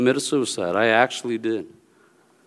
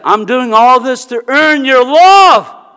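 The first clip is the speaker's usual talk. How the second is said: shouted slowly, higher and louder; retold outburst; 8 semitones higher, 7 dB louder, slower than usual. Sister shouting at her parents that she earns their love, a loud slow shout.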